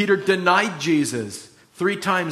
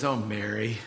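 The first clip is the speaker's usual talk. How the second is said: low, soft naming of a loved one; hushed, knowing reply; gentle acted address; 5 semitones lower, 5 dB quieter than usual. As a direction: say her name tenderly and quietly. Jesus gently reveals himself to Mary.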